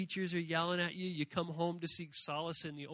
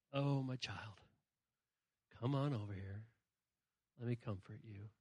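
lower, softer reassuring voice of God; tender comfort; 7 semitones lower, 11 dB quieter than usual. Retold or imitated. God softly comforting a stressed child. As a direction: soothe a hurting child gently. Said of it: "He just listens like".

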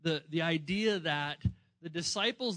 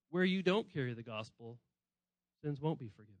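grave, quiet; 6 semitones lower, 5 dB quieter than usual. Retold or imitated. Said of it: "and he's saying".

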